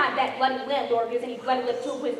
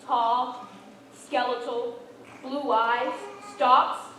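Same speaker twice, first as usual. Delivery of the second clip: normal talking voice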